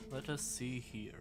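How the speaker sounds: ordinary delivery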